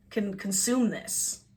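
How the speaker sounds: normal talking voice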